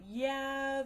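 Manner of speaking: ordinary talk, nothing marked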